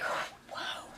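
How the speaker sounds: breathless whispered whoa, mimicking a stunned face. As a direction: mouth a shocked whoa. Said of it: "Tim's face is just like".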